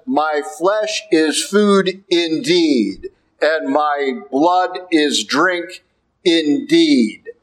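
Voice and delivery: very slow, stress on "food" and "blood", drawn-out "indeed"; solemn slow quote. Acted. Preacher quoting Jesus to the congregation, very slow and solemn. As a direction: weigh down every word of it.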